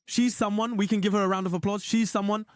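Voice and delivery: usual speaking voice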